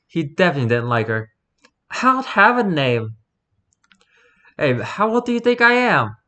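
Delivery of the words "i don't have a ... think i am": put-on high-pitched, incredulous young girl; high girlish disbelief; scoffing girl. A narrator's reading. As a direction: scoff at his silly question, pitched high. Girl scoffs at boy's question.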